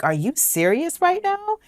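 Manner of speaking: a high, incredulous retold question; mock disbelief. Disbelief at partner's past behaviour.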